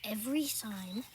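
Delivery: usual speaking voice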